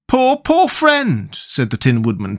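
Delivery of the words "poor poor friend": slow, high, grieving tenderness; tender. A narrator's reading. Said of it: "caressing it tenderly".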